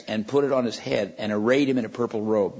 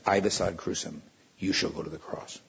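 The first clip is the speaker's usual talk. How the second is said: grave sentence; 6 semitones lower, 7 dB quieter than usual. Retold or imitated. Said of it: "the most dreaded words".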